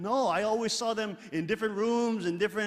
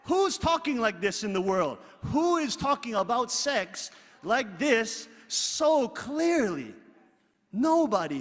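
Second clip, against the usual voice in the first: rhetorical preaching challenge; 4 semitones higher, 6 dB louder, slower than usual. Real, not acted.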